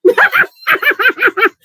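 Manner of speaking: a sudden, loud, high laugh; loud burst of laughter. Real, not acted.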